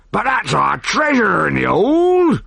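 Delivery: high anguished wail. A narrator's reading. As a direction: wail over the lost treasure. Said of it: "wailed the pirate captain".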